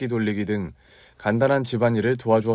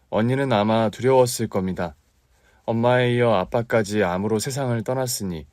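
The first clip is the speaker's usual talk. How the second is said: plain reading voice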